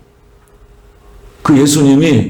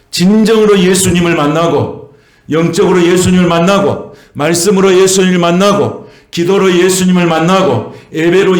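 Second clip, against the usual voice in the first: high, fervent closing blessing; impassioned preaching; 7 semitones higher than usual. Acted. Pastor blessing the congregation at the close, fervent and high. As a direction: build the blessing up and pour it out.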